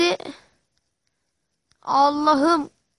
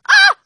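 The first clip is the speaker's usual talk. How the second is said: sudden high scream; screaming while falling; 8 dB louder than usual. Real, not acted. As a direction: yelp as you drop. Screams as he falls.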